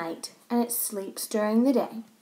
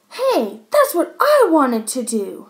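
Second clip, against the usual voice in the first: loud, higher-pitched indignant protest; calling out; 5 semitones higher, 7 dB louder than usual. A narrator's reading. Boy in timeout protesting to teacher, loud indignant call. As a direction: call it out across the classroom. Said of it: "Max called out from his chair".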